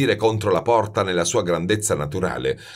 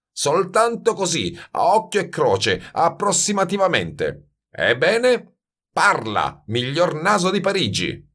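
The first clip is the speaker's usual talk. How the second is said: put-on higher, goading old master; mocking master perfumer; 9 semitones higher than usual. A narrator's reading. Master goads the silent youth to speak, mocking. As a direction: needle him for an answer.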